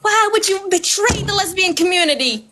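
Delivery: a hint of a self-righteous critic; mocking a critic. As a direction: throw the accusation back in a mock voice. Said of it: "I retire".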